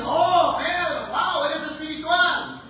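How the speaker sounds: high, exclaimed "Oh, Pedro, wow"; mock over-the-top admiration; gushing praise. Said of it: "Bipolar el maestro. Primero le dice".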